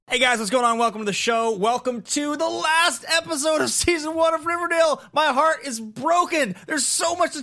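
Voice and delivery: energetic host welcome; show host intro. Acted.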